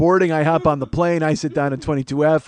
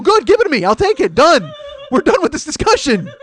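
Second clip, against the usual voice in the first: louder and higher, done deal; loud, high triumph; 11 semitones higher, 5 dB louder than usual. Retold or imitated. To the gate agent, loud triumphant and high. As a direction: bark it out, triumphant.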